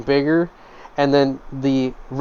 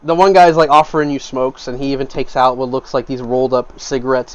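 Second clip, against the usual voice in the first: ordinary delivery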